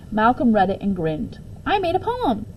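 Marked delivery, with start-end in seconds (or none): 1.7-2.5 high, proud, surprised little voice; proudly surprised at himself; amazed child. A narrator's reading. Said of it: "in a surprised, proud voice".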